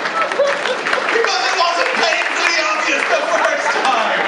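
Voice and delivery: loud, high, drawn-out sarcastic outburst; exasperated shout. Acted. Comedian mocks the survivor's late realisation, exasperated shout. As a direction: shout it in comic disbelief, pitch way up.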